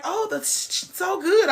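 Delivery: mocking diners who rave about the food; mock gushing diner. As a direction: gush like a dazzled customer. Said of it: "People be like".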